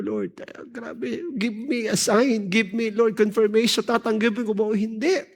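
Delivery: mataas at paiyak na pagdarasal; umiiyak. Retold or imitated. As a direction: magmakaawa sa Diyos na parang naiiyak. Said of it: "Iyak ako na iyak". (ginagayang pagsusumamo nang luhaan)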